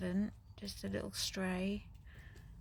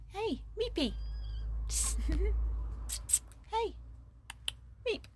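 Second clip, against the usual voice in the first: cooing to the cat; 12 semitones higher than usual. Real, not acted. Greeting the cat Meepy, high cooing baby talk.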